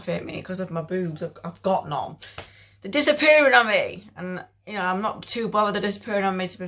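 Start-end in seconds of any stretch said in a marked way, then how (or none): 2.8-4.1 high, loud exclamation falling away at the end; mock outrage. Real, not acted. Vlogger complaining about her vanishing boobs, mock outrage. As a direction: exclaim it in comic dismay.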